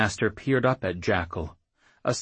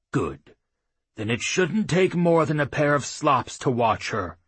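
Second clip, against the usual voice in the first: put-on gruff old chief; 7 semitones higher, 4 dB louder than usual. A narrator's reading. Claymaster gives orders.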